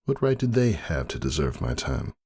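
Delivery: ordinary delivery, nothing marked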